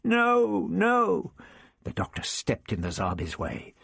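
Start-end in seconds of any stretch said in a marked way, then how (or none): 0.0-1.3 a high frightened moan; Vicky moans as the Zarbi seizes her. A narrator's reading. Vicky pleading as the Zarbi closes in, a frightened moan. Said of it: "she moaned".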